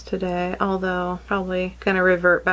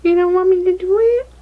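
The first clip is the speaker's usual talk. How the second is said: much higher and louder playful question; mock surprise; 10 semitones higher, 7 dB louder, faster than usual. Real, not acted. Mother teasing her daughter who refuses makeup, high mock surprise.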